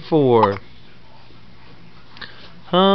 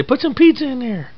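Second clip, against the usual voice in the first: put-on baby voice; 7 semitones higher than usual.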